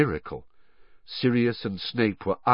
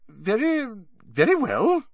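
high, startled reply; 13 semitones higher than usual. Acted. Madame Pomfrey startled by Dumbledore's request. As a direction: caught off guard, agreeing quickly. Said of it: "looking startled".

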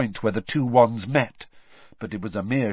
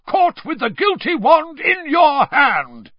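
higher, louder, slow shout; roaring; 9 dB louder than usual. Acted. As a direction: thunder the accusation. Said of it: "Mister Diggory roared".

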